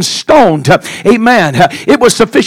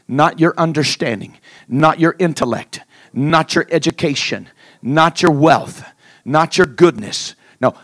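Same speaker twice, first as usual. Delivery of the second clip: hushed sermon; 10 semitones lower, 10 dB quieter than usual. Acted. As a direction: draw the church in quietly. Soft, hushed intimate preaching.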